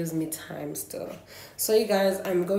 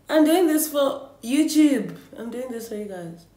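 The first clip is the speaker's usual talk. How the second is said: louder and higher, proclaiming it; emphatic declaration; 6 semitones higher, 7 dB louder than usual. Real, not acted. Vlogger declaring her reason to viewers, emphatic. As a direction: announce it like a cause.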